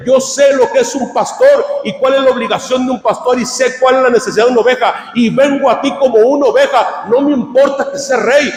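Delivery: rising, fervent preacher's declaration; impassioned preaching. Acted. Preacher voicing a believer's plea to the shepherd, fervent preaching. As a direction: build to a high, shouted declaration of faith.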